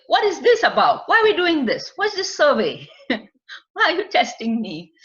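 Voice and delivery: high, loud, suspicious questions; suspicious client. Retold or imitated. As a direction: play the wary client, rising and insistent.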